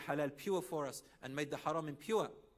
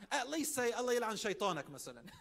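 laughing suggestion; 7 semitones higher, 3 dB louder than usual. Retold or imitated.

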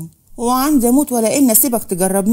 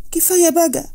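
high, pained plea of كفاية بقى; pleading cry; 6 semitones higher than usual. A narrator's reading. Wife begging her husband to stop lying, high pained plea.

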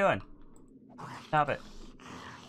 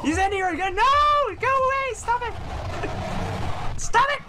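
high, loud panicked screams of go away and stop it; frantic shrieking at the monster; terrified screaming; 11 semitones higher, 6 dB louder than usual. Real, not acted. Monster appears again.

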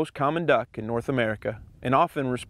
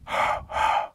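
foggy breath out. Shown as a demonstration. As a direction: breathe out warm air from the chest. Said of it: "Think of how you would fog up a mirror".

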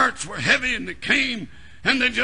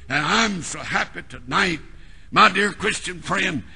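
normal talking voice

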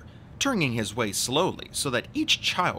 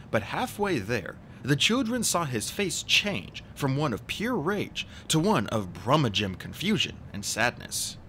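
ordinary delivery

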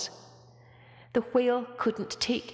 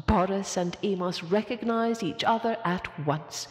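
usual speaking voice

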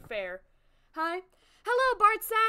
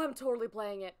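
usual speaking voice

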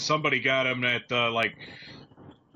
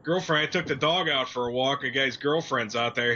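usual speaking voice

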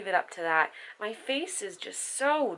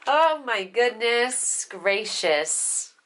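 ordinary talk, nothing marked

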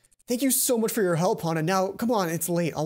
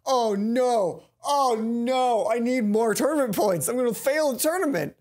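higher and louder, comic alarm; mock panic; 4 semitones higher, 5 dB louder, slower than usual. Real, not acted. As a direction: panic theatrically about points. Panics about tournament points.

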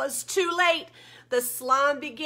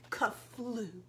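usual speaking voice

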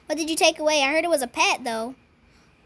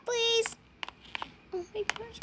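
ordinary talk

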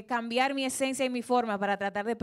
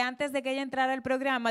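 usual speaking voice

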